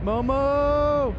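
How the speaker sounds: high, loud call of a name. Real, not acted. Gamer calling out to the cat Momo, loud high call. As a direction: shout the name across the room.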